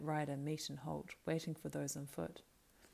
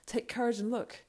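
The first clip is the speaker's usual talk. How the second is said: higher, louder effortful urging; spoke with effort; 7 semitones higher, 5 dB louder than usual. Acted. Beregond urging Pippin to look over the wall, with effort. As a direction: push out a call to courage. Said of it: "spoke with an effort".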